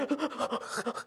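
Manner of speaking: sharp mock gasp; startled gasp. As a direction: gasp in fright. Wife startled.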